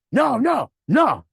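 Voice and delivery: loud, mock-shouted no; loud refusal. New driver loudly refusing his manager, shouted no. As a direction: bark the nos back.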